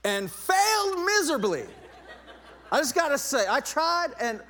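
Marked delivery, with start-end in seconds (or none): 0.0-1.7 high, loud admission falling away at the end; loud comic confession. Real, not acted. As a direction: blurt out the failure with gusto. Comic confession after long pause.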